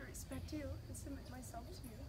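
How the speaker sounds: soft, choked-up vow; emotional vow repeat. Real, not acted. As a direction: hold back tears, softly. Bride repeats vows softly.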